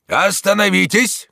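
high, loud, drawn-out order; commanding shout. A narrator's reading. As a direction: shout to halt attackers. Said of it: "крикнул он нападавшим". (властный крик)

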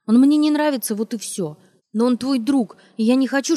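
high, loud, heated; angry outburst. Acted. Wife venting at husband, high and loud. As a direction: keep the outburst going.

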